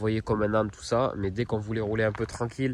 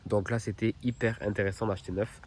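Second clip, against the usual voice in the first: usual speaking voice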